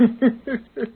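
short high gloating chuckle; quick pleased outlaw cackle; gloating laugh. Acted. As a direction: laugh at the sheriff's mistake. Outlaws gloat over their luck.

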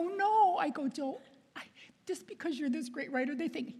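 high, pleading objection; retold protest. Nun retells begging off a book to the agent, high protest. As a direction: balk in a high, reluctant voice.

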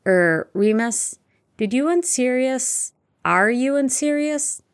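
slow, high, awkward questioning; hesitant, breaking off. A narrator's reading. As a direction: fumble toward a delicate question and stop short. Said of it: "He broke off".

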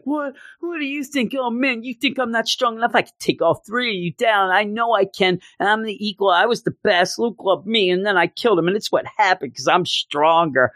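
high-pitched mock hissy fit; whiny freak-out. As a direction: whine it way up high like someone throwing a tantrum. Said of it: "He just freaks out and whines and moans".

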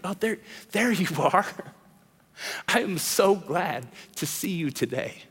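soft, laughing welcome; tender greeting. Acted. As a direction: greet her gently with a little laugh. Jesus greets the accused woman.

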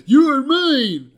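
high, loud, menacing vampire snarl; hammed-up vampire claiming his prey; a big, over-the-top movie vampire voice; vampire impression. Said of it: "That was a fantastic impression".

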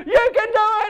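high, strained mock yell; acted yelling. Dad cheering on his pedaling son, high acted yell. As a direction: imitate an elated dad calling out. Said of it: "I'm yelling out to the whole park".

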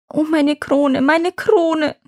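a lightly acted sob for the crown; lamenting princess. Princess mourns the crown the elves stole, mild acted sob. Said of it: "schluchzt Tüfster".

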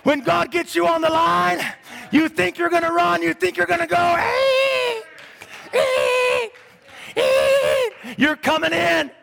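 high, shouted revival preaching; pulpit-pounding sermon voice; fiery preaching. Acted. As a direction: preach it out full force to the church. Preaching at full force.

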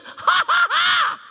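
loud high burst of laughter; big exultant laugh. Real, not acted. Preacher laughing loudly in joy at the good news.

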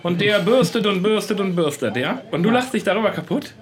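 high-pitched, playful telling-off; mock indignation. Friend takes colleague to task for laughing at a car groomer, mock indignant. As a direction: scold in exaggerated disbelief.

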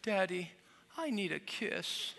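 put-on drawn-out wheedling little-girl voice; pleading little girl. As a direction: imitate a child sweet-talking daddy. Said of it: "And then she'll go".

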